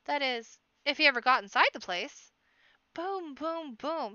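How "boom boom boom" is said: knocking sound. A narrator's reading. Knocking on the door.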